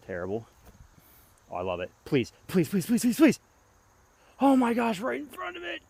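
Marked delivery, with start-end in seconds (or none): 2.1-3.4 rising, begging please; desperate pleading. Real, not acted. Disc golfer begging his throw to drop, desperate. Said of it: "hear the desperation in my voice". 4.4-5.8 high anguished gasp; desperate anguish. Real, not acted. Disc golfer seeing his throw land short, anguished. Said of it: "the desperation in my voice".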